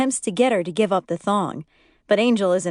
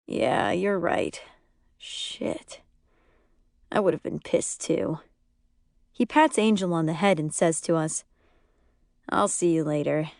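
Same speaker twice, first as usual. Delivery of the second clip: dejected; 3 semitones lower, 4 dB quieter than usual. A narrator's reading. Husband conceding to friends, deflated. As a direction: let it drain out of him.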